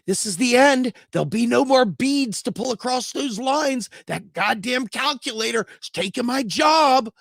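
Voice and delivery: high-pitched melodramatic cartoon wail; panicking abacus. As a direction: play a doomed abacus in high, loud despair. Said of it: "abacuses going".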